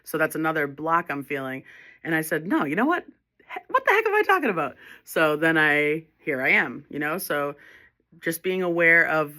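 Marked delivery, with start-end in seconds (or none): 2.5-4.8 very high-pitched, incredulous outburst; indignant self-correction. Retold or imitated. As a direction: shoot way up in pitch, scoffing at your own thought. Acting out pushback to own thoughts.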